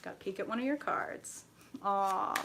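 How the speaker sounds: ordinary delivery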